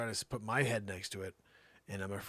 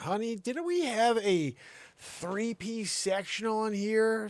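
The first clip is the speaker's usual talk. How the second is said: higher, louder, slow bewildered question; puzzled; 12 semitones higher, 7 dB louder, slower than usual. Retold or imitated. Husband asking wife about the missing couch, slow bewildered. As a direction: drag it out, baffled.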